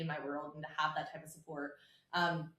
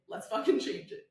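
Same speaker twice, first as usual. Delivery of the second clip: mock pep talk; 4 semitones higher, 5 dB louder than usual. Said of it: "be like".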